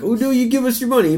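high begging pitch; mock pushy seller. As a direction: whine it like a greedy beggar. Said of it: "not trying to sell it hard".

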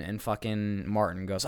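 normal talking voice

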